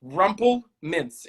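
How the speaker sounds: slow pronunciation. Shown as a demonstration. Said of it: "is it Rumplemints?".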